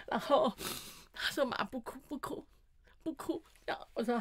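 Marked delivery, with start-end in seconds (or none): 1.5-3.4 small, high, hushed pleading; imitated tearful son. Son comforting crying mother in elevator, tearful and hushed. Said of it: "我儿子也哭到泣不成声".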